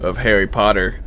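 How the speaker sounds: ordinary talk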